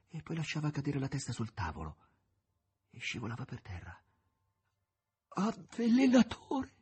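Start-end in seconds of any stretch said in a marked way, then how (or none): 5.3-6.7 high, loud, slowed cry; dying cry. A narrator's reading. The poisoned master crying out at Azazello, high and anguished. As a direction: tear it out with the last breath. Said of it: "fece ancora in tempo a gridare".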